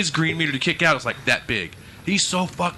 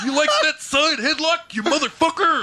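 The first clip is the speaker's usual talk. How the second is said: mock wrestler taunt; 10 semitones higher than usual. Taunting opponent in game.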